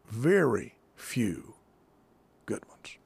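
"very few" drawn out slowly, "good ones" dropped to a whisper; slow stress on very few. Shown as a demonstration. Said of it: "I might say it this way".

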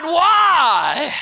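high, loud anguished cry of why. Acted. Preacher crying out Christ's question to the congregation, anguished. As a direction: cry it out to heaven.

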